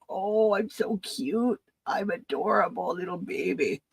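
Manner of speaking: slow, cutesy pretend dog voice; voicing the dog. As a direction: speak for the puppy as if he's bragging.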